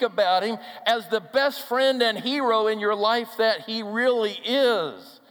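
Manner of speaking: loud, high, slow preaching build; impassioned sermon peak; full-force preaching. Acted. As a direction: proclaim it to the whole room.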